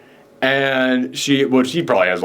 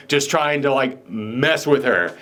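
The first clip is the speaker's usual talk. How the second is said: usual speaking voice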